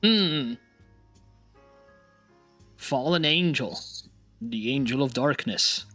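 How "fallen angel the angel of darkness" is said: ordinary delivery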